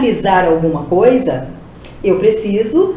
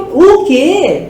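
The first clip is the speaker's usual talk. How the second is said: louder, higher, drawn-out shocked question; demonstrated burst of disbelief; astonished; 5 semitones higher, 5 dB louder than usual. Shown as a demonstration. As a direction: act out astonishment with heavy emphasis. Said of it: "Eu estou espantada".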